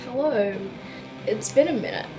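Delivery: ordinary talk, nothing marked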